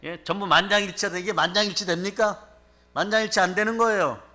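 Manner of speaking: normal talking voice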